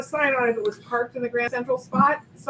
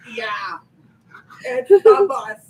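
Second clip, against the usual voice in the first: mock New Jersey accent; 11 dB louder, much slower than usual. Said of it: "such a heavy New Jersey accent".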